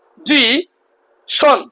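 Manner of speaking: Position in three separate beats, po-zi-shon; position very slowly, a gap after each syllable. Shown as a demonstration. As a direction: break position into three slow syllables with pauses. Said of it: "তিনটা অংশে ভাগ করে বলতে পারলাম".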